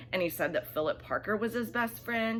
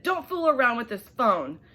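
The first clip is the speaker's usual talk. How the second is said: higher, louder mock-stern dad; a firm warning from dad; dad warning his son, hammed-up stern; 6 semitones higher, 6 dB louder than usual. Dad warns boy.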